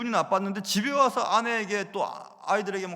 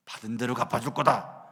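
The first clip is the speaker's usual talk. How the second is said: mock vengeful voice; 6 semitones lower than usual. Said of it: "이런 태도를 가졌습니다".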